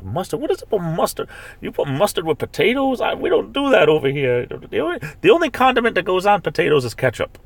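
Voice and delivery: high-pitched, disbelieving protest; incredulous outrage. Real, not acted. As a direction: object as if the food is an affront. Balks at mustard in a recipe.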